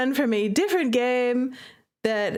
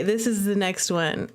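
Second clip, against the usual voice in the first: usual speaking voice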